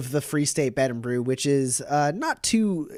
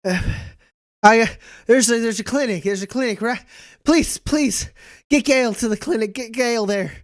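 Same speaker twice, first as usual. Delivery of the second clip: high, pleading desperate voice; 9 semitones higher, 3 dB louder than usual. Acted. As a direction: beg frantically for help. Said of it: "she goes".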